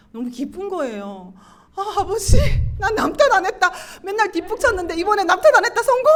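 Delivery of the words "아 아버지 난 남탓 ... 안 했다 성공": high, loud cry of victory; elated triumph. Retold or imitated. Woman proudly reporting her win to God, elated triumph. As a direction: burst out excitedly, pitched up and loud.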